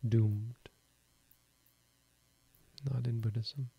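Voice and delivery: ordinary talk, nothing marked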